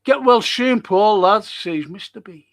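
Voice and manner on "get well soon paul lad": loud, high, sarcastic send-off; high, loud mock farewell; mocking quote. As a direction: throw the well-wishes out with a sneer.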